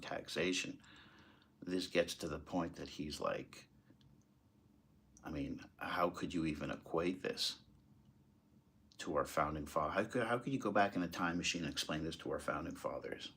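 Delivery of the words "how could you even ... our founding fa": ordinary talk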